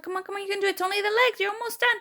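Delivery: high cheering voice; encouraging nurse. Retold or imitated. Nurse cheering on the last push, imitated encouragement. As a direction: cheer them through the last bit. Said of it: "The nurse was like".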